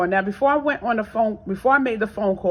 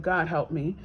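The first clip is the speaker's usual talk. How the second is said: muttered plea; 7 semitones lower, 8 dB quieter than usual. Retold or imitated. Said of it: "I said".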